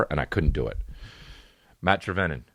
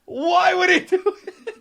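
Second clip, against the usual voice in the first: laughing; slower than usual. Real, not acted. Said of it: "laughing through the question".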